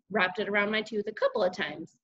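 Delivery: ordinary delivery, nothing marked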